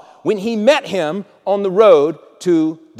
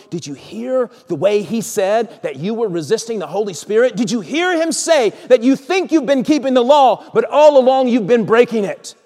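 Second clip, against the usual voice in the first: rising higher and louder; building accusation; 6 semitones higher, 4 dB louder than usual. Acted. Conscience confronting Saul over the law, mounting accusation. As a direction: press harder with each charge.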